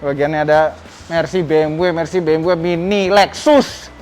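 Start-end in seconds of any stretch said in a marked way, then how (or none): none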